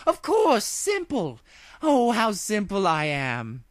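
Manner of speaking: high, slow regal exclaiming; delighted princess. Acted. Luna pacing before the ponies, delighted at her idea. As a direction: savour your own foolishness with glee.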